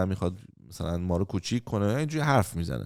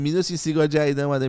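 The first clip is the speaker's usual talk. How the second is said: ordinary talk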